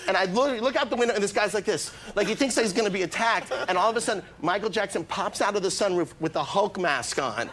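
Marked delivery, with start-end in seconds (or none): none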